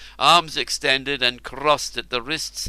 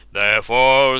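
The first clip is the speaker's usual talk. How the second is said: regal king voice; 8 dB louder than usual. Acted. Ubar speaks.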